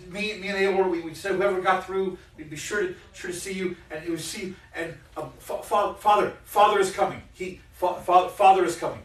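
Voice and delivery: high, loud, tripping over her words; breathless Fiala blurting news to her friend; excited stammering. Acted. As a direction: stumble over father in a rush.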